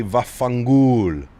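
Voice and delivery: Vaffangulo drawn out slowly with an Italian lilt; Italian pronunciation. Shown as a demonstration. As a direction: savour Vaffangulo like a proud Italian. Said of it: "That's the only thing I can say properly".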